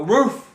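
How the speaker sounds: high, loud single woof; imitated dog bark. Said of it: "you'll hear a dog go".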